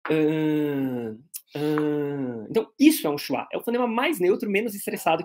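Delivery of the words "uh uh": "Ah" as a long, low, monotone hesitation uh; schwa as if thinking. Shown as a demonstration. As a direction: hum out a neutral "uh", as if thinking. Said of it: "como se você estivesse pensando".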